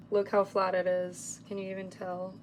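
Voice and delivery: ordinary talk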